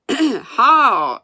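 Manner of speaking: loud, high driving holler; shouted command. A narrator's reading. Boy steering calves out in the open, loud shout. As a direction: holler a turn to the team. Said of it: "He shouted".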